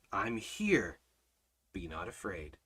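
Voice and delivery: low, soft, unhurried comfort; reassuring Almighty Father. Acted. God answering fear of the enemy, low and soothing. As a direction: let the voice sink into calm. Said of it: "What does our, our Almighty Father say?".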